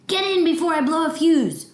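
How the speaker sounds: high, heated outburst; steaming angry shout. Acted. Grandma ordering the child inside, shouting furiously. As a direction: yell like you're about to explode. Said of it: "A hot, steamy sound came out".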